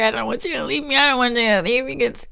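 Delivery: high, cracking plea close to crying; high teary plea to grandma; near tears. Retold or imitated. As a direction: beg with the voice breaking. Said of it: "I was almost about to cry".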